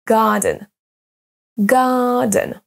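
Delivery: "garden" as GAR-den, long first syllable; first-syllable stress. Shown as a demonstration. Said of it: "c'est la même chose pour".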